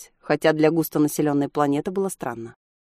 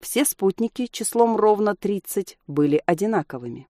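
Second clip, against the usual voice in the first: usual speaking voice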